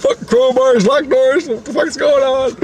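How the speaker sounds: high, squawked list of complaints; baffled demand. Retold or imitated. James reacting to crowbar and locked door, baffled demand. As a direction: imitate an incredulous, flustered coworker.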